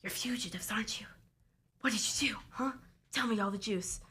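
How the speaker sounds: hushed, prying whisper; conspiratorial whisper. Acted. Ramen lady questions you in the dark corner, conspiratorial whisper. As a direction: whisper it like a secret interrogation. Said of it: "pulls you to the dark corner".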